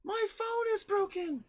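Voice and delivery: put-on high, slow old-lady voice; imitated grandmother, slow and very high; grandma impression. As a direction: complain like a distressed grandma in falsetto. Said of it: "And she was like".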